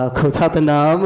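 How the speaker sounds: loud call for the sangat to answer Satnam. Real, not acted. Preacher calls on the listening congregation, loud rising Satnam.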